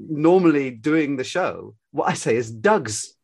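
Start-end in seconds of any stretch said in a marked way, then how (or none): 2.5-3.2 "Dogs." in a broad Glaswegian accent. Shown as a demonstration. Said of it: "Very, very Glaswegian".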